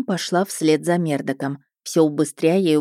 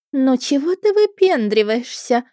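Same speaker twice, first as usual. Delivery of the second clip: languid mermaid; 8 semitones higher than usual. A narrator's reading. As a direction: draw it out lazily, high and teasing. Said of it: "томно протянула вторая". (томная юная русалка)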